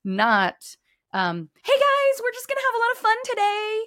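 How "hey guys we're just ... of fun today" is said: very high, quick sing-out of fake cheer; over-the-top pretend pep, pitched way up; fake cheerful. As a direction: put on a squeaky-high, phony party-host greeting. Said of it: "It's not fake".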